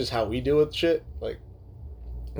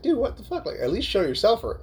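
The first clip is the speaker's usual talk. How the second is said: high-pitched retold exasperation; bothered complaint; 8 semitones higher than usual. Host replaying his gripe about the screen, exasperated. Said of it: "it bothers me".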